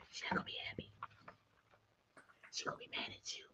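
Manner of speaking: very soft unvoiced whisper; conspiratorial whisper. Real, not acted. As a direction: whisper it like a secret. Teasing about dog's jealousy.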